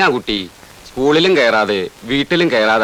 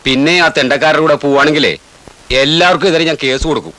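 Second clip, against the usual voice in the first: loud, raised-pitch warning; stern threat; 4 semitones higher, 4 dB louder than usual. Acted. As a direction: lay down the law to a child.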